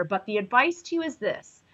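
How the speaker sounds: normal talking voice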